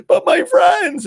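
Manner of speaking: very high, loud mock preteen protest; hammed-up girlish complaint, squeaked high; whining daughter. Daughter protesting the trip to dad, mock high whine. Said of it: "she's, like, freaking out".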